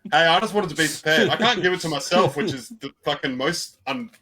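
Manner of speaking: loud, defensive hey; loud protest. Real, not acted. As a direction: defend yourself loudly. Defensive outburst.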